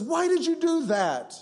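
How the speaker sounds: a high, pained "why"; shocked boy. Acted. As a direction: cry out stung and betrayed. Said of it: "And the boy says".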